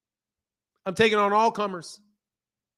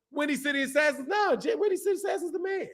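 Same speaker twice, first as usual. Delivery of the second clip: much higher, excited call of the name; hyped shout-out to Windy City Assassin; hype shout-out; 8 semitones higher, 5 dB quieter than usual. Real, not acted. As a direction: hype up the viewer. Hyping a viewer's name.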